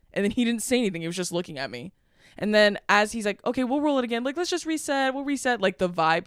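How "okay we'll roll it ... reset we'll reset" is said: higher-pitched placating imitation; smoothing things over. Crew tries to save the energy after a row, placating. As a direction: mimic a crew keeping the mood up. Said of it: "they're trying to, like, save the energy".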